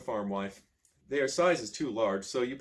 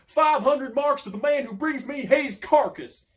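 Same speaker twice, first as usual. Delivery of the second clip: much higher, loud, slow fury; furious bounty cry; 12 semitones higher, 7 dB louder, slower than usual. A narrator's reading. Sheriff raging at the guards, high, loud and slow. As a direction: hurl the offer across the hall.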